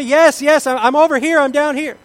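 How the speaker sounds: high loud anxious call upward; calling back. Acted. Stranded man calls up to the unseen voice, loud and anxious. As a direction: shout up to the voice above.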